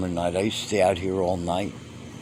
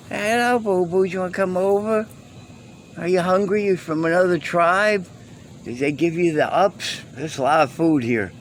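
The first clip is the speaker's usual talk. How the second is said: high, sweet pet-talk voice; talking to cats; 9 semitones higher than usual. Real, not acted. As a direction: coo at the stray cats like babies.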